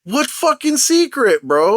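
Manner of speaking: high, slow exasperated retort; drawn-out, high-pitched mock outrage; annoyed. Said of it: "I was annoyed".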